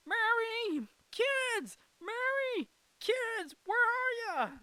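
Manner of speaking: very high, loud calls through the house; George Bailey. Retold or imitated. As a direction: call out eagerly for wife and children, word by word. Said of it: "looking for his kids".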